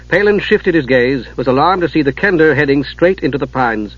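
usual speaking voice

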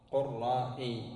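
قرائي in split syllables: "qur-rā-'ī"; hamza kept. Shown as a demonstration. Teacher giving the nisba of القراء, قرائي said "qur-rā-'ī". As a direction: say قرائي deliberately, clear glottal stop before ī. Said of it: "تقول في النسب إلى القراء".

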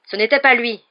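high, loud roar of defiance. A narrator's reading. Young man defending his creature to Jedusor, roaring. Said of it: "rugit le jeune homme".

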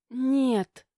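ordinary delivery

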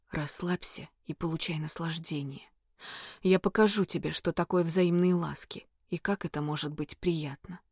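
low, soft whisper; demonic whisper. A narrator's reading.

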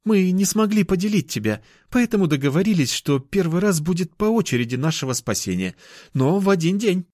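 put-on high girlish explanation; apologetic cat girl. Girl explaining the girls' agreement, high and apologetic.

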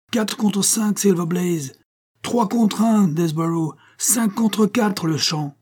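high shouted odds call; bookmakers shouting. A narrator's reading. A bookmaker hollering the odds to the crowd. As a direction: call the odds out across the ring. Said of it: "hurlait-on dans le ring".

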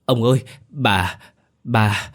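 a frightened servant stammers to her master; terrified stammering. A narrator's reading. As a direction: stumble over the words in terror. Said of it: "nói lắp bắp".

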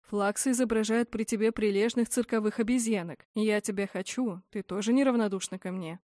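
plain reading voice

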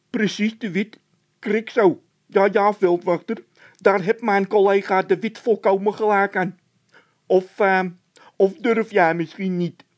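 high, loud panicky voice; frightened Bol. A narrator's reading. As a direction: panic about the noise. Bol taunts officer. (Bol in paniek, hoog en luid)

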